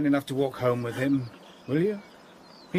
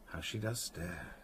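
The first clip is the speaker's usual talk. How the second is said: musing aside; 7 semitones lower, 6 dB quieter than usual. Acted. Captor muses aside on her stare.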